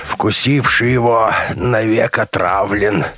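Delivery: fanatic doctor preaching. A narrator's reading. Crazed doctor chanting scripture to the soldiers, fanatical. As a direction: declaim scripture with mounting fervour.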